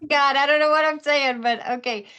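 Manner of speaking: nervous laugh in the voice, caught off guard; high, loud, flustered; flustered panic. Retold or imitated. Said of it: "I was like".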